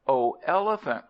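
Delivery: cried out. A narrator's reading. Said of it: "cried the man".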